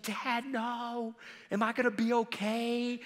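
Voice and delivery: high, soft, whimpering mock sob; imitated sobbing. Hurt daughter asking her dad for reassurance, imitated sobbing. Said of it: "she just starts sobbing".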